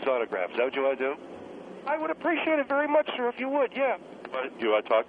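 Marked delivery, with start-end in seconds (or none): 1.9-4.0 put-on higher-pitched, polite kid voice; polite young caller. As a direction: play an eager, respectful kid caller.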